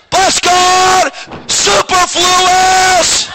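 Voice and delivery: high, loud, very slow preacher's cry on superfluous; waxing eloquent. Retold or imitated. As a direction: belt it out like a preacher carried away. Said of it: "I'm waxing eloquent".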